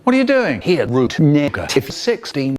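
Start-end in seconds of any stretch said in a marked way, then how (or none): 0.0-0.9 startled challenge. Acted. Sudden confrontation line.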